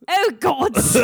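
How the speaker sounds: cockney through laughter. Pretend. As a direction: echo it in hammed-up cockney. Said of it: "I like cockney, Cassius".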